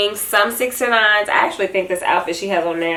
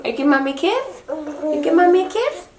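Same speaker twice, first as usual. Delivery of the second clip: higher sing-song ask; 9 semitones higher than usual. Real, not acted. Mother asks baby for a kiss. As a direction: coo for a kiss.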